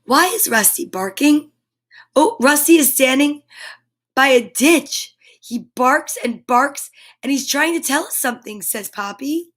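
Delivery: bright, lively storybook read-aloud; animated picture-book narration; reading to children. A narrator's reading. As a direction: read it like story time for little ones. Reads picture book to kids.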